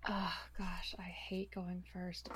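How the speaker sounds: soft nervous whisper. Real, not acted. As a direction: whisper it to yourself, dreading it. Nervous aside before her turn.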